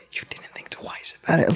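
whispered, high disbelief; stunned whisper. Retold or imitated. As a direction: breathe it out, stunned. Stunned by her reply.

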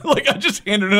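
normal talking voice

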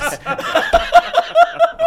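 high, loud cackling laugh; big burst of laughter. Real, not acted. Host cracking up at the guest's joke, loud high laughter.

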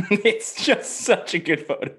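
high, slow, giggly admiration; high amused drawn-out praise; laughing delight. Real, not acted. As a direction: gush through laughter. Laughing at the photo.

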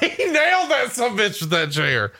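high-pitched, laughing astonishment; amazed reaction. Real, not acted. Co-host watching a chair shot, real amazement. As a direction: exclaim it high, stretching out the last words.

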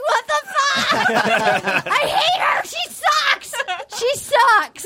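high, drawn-out comic wail, laughing; laughing imitation of a hurt rant; mock jealous tantrum. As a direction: whine it way up high like a hammed-up meltdown. Tammy's retold jealous outburst.

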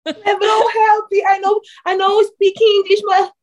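high, loud wailing plea; hammed-up cry for help; mock crying shout. Athlete pretending to beg LeBron for help, mock wail.

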